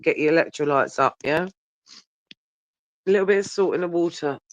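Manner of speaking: ordinary talk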